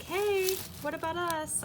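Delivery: high little voice for the bulbs; talking bulbs. Pretend. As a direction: give the bulbs a high pleading voice. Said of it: "Some more bulbs that are like".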